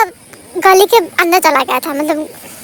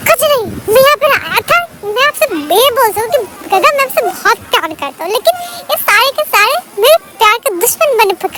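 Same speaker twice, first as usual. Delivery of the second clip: 1 flustered confession; 5 semitones higher than usual. Retold or imitated. Wing blurting confession in anger.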